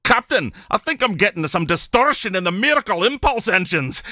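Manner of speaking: high, hammed-up Scottish engineer; Scotty. Facilitator joking to his study group, high Scottish accent. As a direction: cry out in a broad Scottish accent. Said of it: "becomes nicknamed Scotty".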